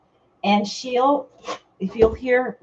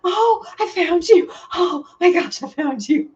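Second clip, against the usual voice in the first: squealed, much higher and louder; relieved cat voice; 8 semitones higher, 5 dB louder than usual. Retold or imitated. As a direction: gush like an overjoyed cat finding its owner. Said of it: "they're like".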